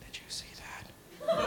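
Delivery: hushed scared whisper. Retold or imitated. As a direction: whisper so nobody hears. Brother whispering in the dark.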